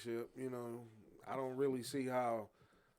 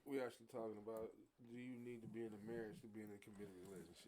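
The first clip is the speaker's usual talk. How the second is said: hushed, much softer than usual; talking low; 13 dB quieter than usual. Real, not acted. Host to co-hosts restating the marriage question, talking low. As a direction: keep it down to a near murmur. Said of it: "'Cause you know he talking low".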